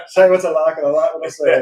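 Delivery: put-on broad, loud Yorkshire accent saying. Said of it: "please don't complain about my Yorkshire accent".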